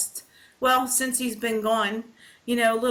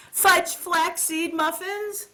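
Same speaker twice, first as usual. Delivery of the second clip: squeaky high disbelief, drawn out then yelped; gasping at the fudge flaxseed muffins; shocked Robin voice; 9 semitones higher, slower than usual. Retold or imitated. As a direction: pitch way up in mock outrage. Robin voiced reacting to freezer.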